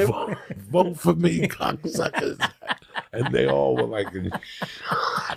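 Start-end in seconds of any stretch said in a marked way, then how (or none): 0.1-2.4 high mock campaign shout, cracking up; laughing mock vote-for-me; laughing heckle.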